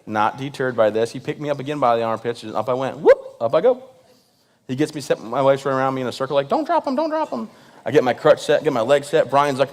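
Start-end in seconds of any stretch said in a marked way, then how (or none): 3.0-3.4 high, quick imitated "whoop"; hoisting whoop. Comic retelling of being lifted by bodyguard, high whoop. 6.5-7.5 very high panicky wife voice; frantic wife. Retold or imitated. Imitating wife circling him as he's lifted, high frantic voice.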